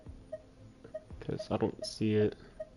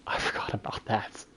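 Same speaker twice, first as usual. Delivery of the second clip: whispered aside; 5 dB quieter than usual. Real, not acted. Realizes a forgotten thing mid-game.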